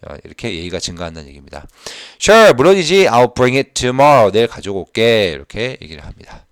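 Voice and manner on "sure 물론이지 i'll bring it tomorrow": much louder, higher cheerful reading; loud model read. Shown as a demonstration. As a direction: belt out the answer for the class. Teacher reads cheerful reply. (크고 밝게 읽기)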